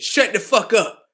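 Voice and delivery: irritated shout; angry snap. Acted. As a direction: cut him off sharply.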